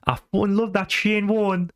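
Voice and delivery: hammed-up Geordie football fan; Geordie accent. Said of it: "He went".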